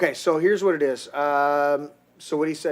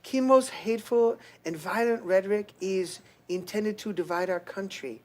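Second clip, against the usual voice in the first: mock First Lady accent, voice lightened; Melania accent; 3 semitones higher, 5 dB quieter than usual. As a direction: read it in a soft Eastern European accent. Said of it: "can you do her voice".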